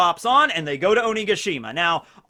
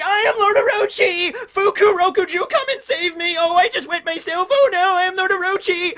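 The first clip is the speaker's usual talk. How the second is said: hammed-up crybaby villain, very high and loud; crying Orochi; 13 semitones higher, 5 dB louder than usual. Cornered shogun begging to be saved, mock crying. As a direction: wail pitifully in a put-on high whiny voice. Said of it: "he's just crying in the corner".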